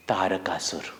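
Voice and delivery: plain reading voice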